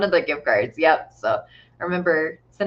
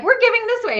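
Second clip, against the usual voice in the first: excited announcing; 13 semitones higher, 6 dB louder, faster than usual. Retold or imitated. Retelling her giveaway announcements.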